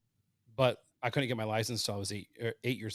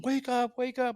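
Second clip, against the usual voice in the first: mock yell, pitched much higher; retold yelling; 14 semitones higher, 4 dB louder, slower than usual. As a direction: call out high to wake a sleeping kid. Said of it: "they're yelling".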